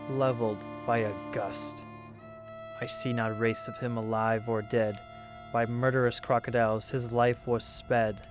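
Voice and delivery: usual speaking voice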